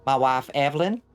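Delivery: country North Georgia twang; North Georgia accent. Shown as a demonstration. Showing chat the wife Evelyn's kind of North Georgia accent. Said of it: "North Georgia kind of accent".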